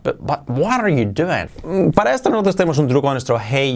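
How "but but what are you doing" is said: "what" stretched and swung in "what are you doing?"; emphatic, incredulous-style "what are you doing?"; exaggerated English intonation. Shown as a demonstration. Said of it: "en inglés todavía es mucho más".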